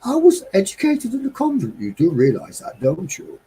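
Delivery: high-pitched, very posh convent-educated lady; Margaret. Retold or imitated. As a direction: imitate a well-spoken lady putting someone in their place. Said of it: "Margaret was very posh and convent".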